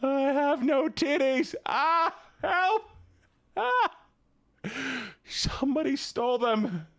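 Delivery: high, hammed-up wail; mock distress. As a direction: cry out in pretend panic for help. Mock cries for help.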